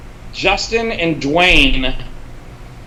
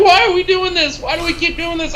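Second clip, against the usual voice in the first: much higher, frantic retold whine; Morty-style panic; 12 semitones higher than usual. Retelling a cartoon scene about a grueling film shoot, panicky high whine. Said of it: "that Rick and Morty episode".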